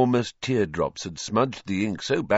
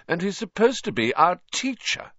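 mocking; 11 semitones higher than usual. A narrator's reading. Malfoy mocks Hagrid.

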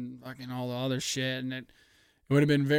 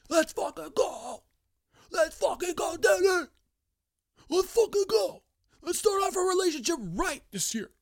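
very high, shouting celebration; excited cheering. Retold or imitated. Fan cheering for Denny, high excited yelling. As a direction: cheer the driver on at full pitch. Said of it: "and I'm like".

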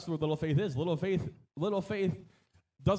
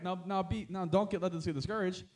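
ordinary talk, nothing marked

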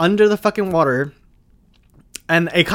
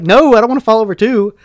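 higher, loud whiny refusal; friend's loud refusal; 6 semitones higher, 9 dB louder than usual. Retold or imitated. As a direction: refuse loudly, scared to tip over. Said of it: "She's like".

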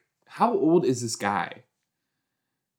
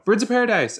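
excited; 11 semitones higher, 4 dB louder, much faster than usual. Real, not acted. Excited at show title.

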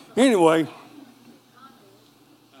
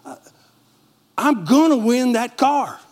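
retold excitement; 5 semitones higher than usual. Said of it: "So I got excited and I said".

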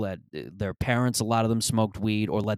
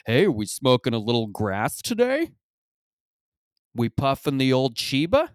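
raised, hammed-up dorky dad voice; dad trying to sound cool; 4 semitones higher, 4 dB louder than usual. Dad trying to sound cool in front of the kids, hammed-up. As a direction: pitch it up, lifting at the question's end. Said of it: "I sound like your fucking dad".

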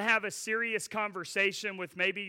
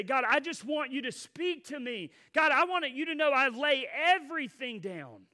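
surrender prayer; 7 semitones higher than usual. Retold or imitated.

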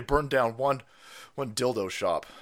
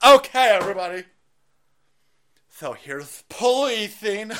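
Kamala Harris; 8 semitones higher, 8 dB louder than usual. Retold or imitated. Host parodying a politician explaining diagrams, loud lecturing voice. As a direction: announce it loudly like a giggly explainer. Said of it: "renditions of Kamala Harris's speech patterns".

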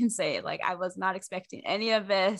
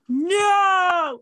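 much higher and louder shouted no, dissolving into laughter; panicked no; 12 semitones higher, 9 dB louder than usual. Retold or imitated. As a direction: yelp it in mock panic. Retold panic at friend's message.

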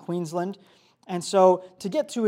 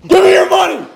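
screamed robbery demand; 10 semitones higher, 14 dB louder than usual. Acted. Volunteer on stage screams at the preacher, high and loud. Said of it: "You just gotta scream".

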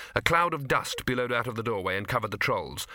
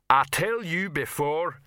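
slow, higher troll voice with mild menace; menacing troll; 5 semitones higher, 3 dB louder, slower than usual. A narrator's reading. Rock warning a troll about eating people, mildly menacing. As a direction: warn them slowly, troll-style. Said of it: "said Rock menacingly".

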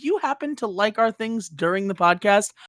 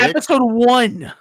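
loud, slow, raised-pitch correction; emphatic correction; 4 semitones higher, 5 dB louder, slower than usual. Real, not acted. Host correcting co-host's episode guess, loud and emphatic. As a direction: shout it as a drawn-out correction.

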